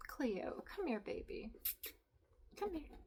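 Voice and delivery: coaxing softly. Real, not acted. Calling cat softly.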